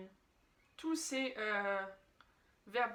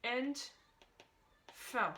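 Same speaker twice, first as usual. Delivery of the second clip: isolated German prefixes, one at a time; slow, spaced-out recital for memorising; syllable by syllable; much slower than usual. Shown as a demonstration. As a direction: list each prefix separately with long pauses. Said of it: "préverbes à connaître".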